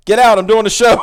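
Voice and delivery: loud, high shout at the intruder; angry dad yell. Retold or imitated. Retelling a friend's dad interrupting her show, loud mock yell. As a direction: bark it out like a dad barging in on a livestream. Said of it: "He's like".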